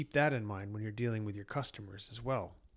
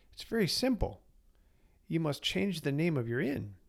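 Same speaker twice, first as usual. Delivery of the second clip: high, calm sage voice; wise old sage; 5 semitones higher than usual. Acted. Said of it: "the sage said".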